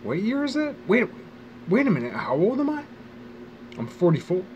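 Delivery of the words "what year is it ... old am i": high, startled self-quote; retold surprise. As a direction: act out the sudden double take. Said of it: "I was like".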